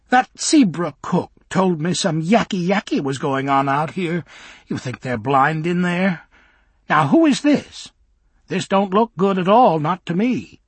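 tut-tut like a suspicious nurse; scolding old minder. Acted.

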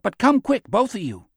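urgent, high-pitched summons; calling out. A narrator's reading. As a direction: call them over in a hurry. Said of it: "Zeke called out".

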